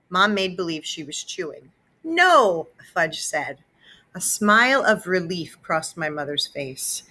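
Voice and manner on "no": put-on high little-boy voice; cheerful small child; toddler voice. A narrator's reading. Fudge answering mom, high toddler voice.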